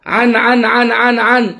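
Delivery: bouncy engine sound; playful tuk-tuk noise. Shown as a demonstration. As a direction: make the tuk-tuk noise for a child. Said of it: "بأسلوب فيه فرح ومرح ولعب".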